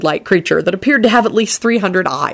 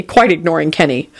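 ordinary delivery, nothing marked

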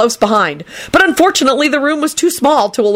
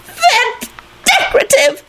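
very high, drawn-out shrill protest; angry yell; slower than usual. A narrator's reading. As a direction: yell back in tearful, stretched squeal. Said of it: "Loretta yelled back angrily".

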